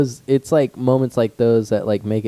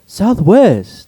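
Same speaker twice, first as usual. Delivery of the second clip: high, loud mock declaration; retold defiant answer; 9 semitones higher, 10 dB louder than usual. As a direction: announce it like the cornered classmate.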